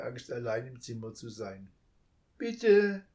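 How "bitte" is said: put-on high, loud child's call; calling after him. A narrator's reading. Child calls after the leaving thief. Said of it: "rief es dem Dieb nach".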